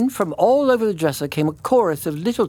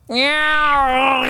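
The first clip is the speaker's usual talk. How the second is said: high, drawn cat meow; the cat answers the sleeping tailor, meowing; 9 semitones higher, 4 dB louder than usual. Acted. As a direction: yowl like a cat at night. Cat meows at night.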